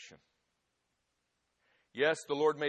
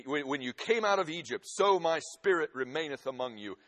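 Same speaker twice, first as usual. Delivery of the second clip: full-force preaching; 2 semitones higher, 9 dB louder than usual. Acted. Preacher to congregation, loud impassioned preaching. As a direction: thunder the prophet's promise to the church. Preacher proclaims Haggai's words.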